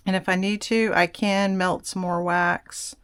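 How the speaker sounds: ordinary talk, nothing marked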